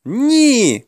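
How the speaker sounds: high, loud protest; boy's cry. A narrator's reading. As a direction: shout it high, like a scared youth. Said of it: "кричав юнак".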